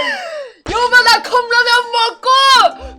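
hammed-up wail. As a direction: overplay the shocked, grateful husband. Husband hams tearful gratitude.

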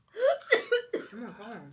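slow, stretched-out amazement; drawn-out oh my God. Real, not acted. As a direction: stretch it out in disbelief. Friend watching her eat spicy powder.

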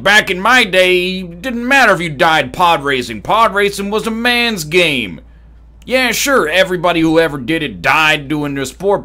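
put-on grumbling old-timer. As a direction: hold forth indignantly like a pod-racing veteran. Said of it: "then everyone would be like".

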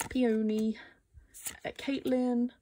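ordinary talk

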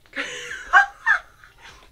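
a high, loud shriek of a laugh. Real, not acted. To the member's photo, a high shrieking laugh. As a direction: let a squealing laugh burst out.

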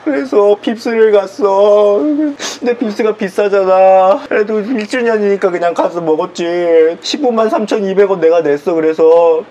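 hammed-up crying while telling the story; tearful whining. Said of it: "왜, 왜 또 오는데?". (울먹이며 하소연)

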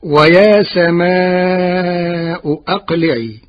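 slow, chanted recitation of ويا سماء أقلعي; Quran recitation. Acted. Teacher reciting the verse to his class, slow and chanted. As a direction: intone the verse slowly like tajweed.

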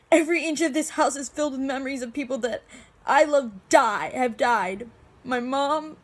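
desperate, grief-stricken protest; anguished grief. Acted. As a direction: build in distress toward breaking down. Acted grief outburst listing the dead.

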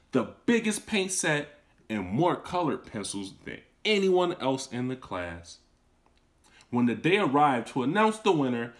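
gentle read-aloud storytelling; storybook narrator voice; story narration. A narrator's reading. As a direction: read it to a class of children. Teacher reads story aloud.

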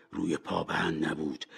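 ordinary delivery, nothing marked

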